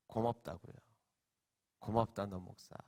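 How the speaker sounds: low, hushed retold reply; subdued thanks. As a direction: say thanks quietly, pitched right down. Retells his moved reply. (낮고 작게 건네는 고마움)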